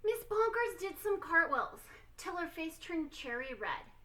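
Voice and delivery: ordinary delivery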